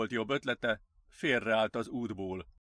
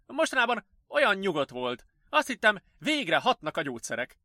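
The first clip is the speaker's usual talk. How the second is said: higher-pitched, troubled nurse; bewildered nurse; 8 semitones higher, 4 dB louder than usual. Acted. Nurse puzzled by the patient's relapse, bewildered. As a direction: voice a puzzled care worker.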